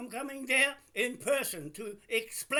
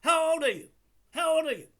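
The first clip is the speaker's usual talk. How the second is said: loud, higher, repeated challenge; angry demand; 7 semitones higher, 8 dB louder, faster than usual. Real, not acted. Man demanding the caller's age, angry shout. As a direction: hammer the question at her.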